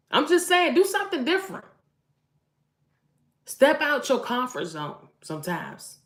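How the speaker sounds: fired-up urging. Real, not acted. Mom telling wives to change things up, loud and excited. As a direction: still high and loud, pressing the point.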